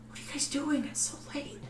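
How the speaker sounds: woman's voice. Acted. GM voices woman caught sneaking.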